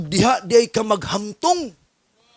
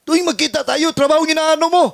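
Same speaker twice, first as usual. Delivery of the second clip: pastor's sharp rebuke, raised; quoted scolding pastor; 6 semitones higher, 5 dB louder than usual. Retold or imitated. Pastor rebukes Jun over his work, raised mock scolding. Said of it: "Sabi mo, pastor".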